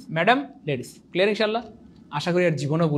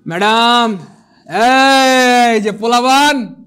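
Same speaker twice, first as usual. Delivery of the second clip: loud slow call, much louder; 4 semitones higher, 10 dB louder, much slower than usual. Acted. Teacher hails the naughty students, loud slow call-out. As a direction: call across the room to the naughty boys.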